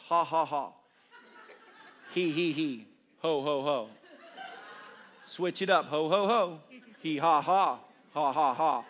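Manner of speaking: switching between ha, he and ho laughs; demo fake laughs. Shown as a demonstration. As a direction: show how to laugh on purpose. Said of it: "You laugh like this".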